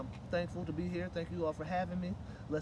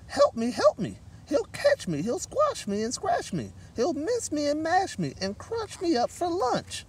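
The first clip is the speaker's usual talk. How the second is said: high, panicky mouse pleading; frightened squeak; 11 semitones higher, 10 dB louder, slower than usual. Acted. Mouse begging for help from the gorilla, high and scared. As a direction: squeak it out in terror. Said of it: "she squeaked".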